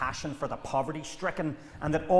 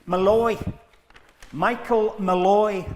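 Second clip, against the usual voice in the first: loud, slow correction of his own name; insisting on his name; 4 semitones higher, 9 dB louder, slower than usual. Acted. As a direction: bark the name back to shut down the nickname. Angry at being called Paddy.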